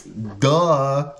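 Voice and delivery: playfully mocking herself in a silly voice. Vlogger teasing herself, silly put-on voice. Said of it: "I'm always making that voice".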